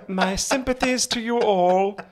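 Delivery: high, slow, ludicrously posh voice; mock regal address in a plummy English accent; the Queen. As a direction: parody a posh monarch, drawn out. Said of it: "the fucking Queen talking about Corona".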